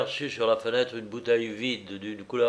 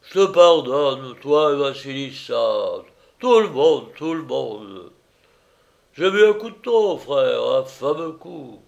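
loud, slowed tipsy largesse; high, sprawling drunk declaration; drunken master, magnanimous; 3 semitones higher, 8 dB louder than usual. A narrator's reading. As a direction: forgive everyone with boozy generosity.

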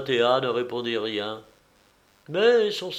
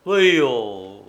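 plain reading voice

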